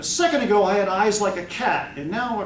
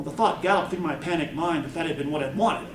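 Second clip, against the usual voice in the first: ordinary delivery, nothing marked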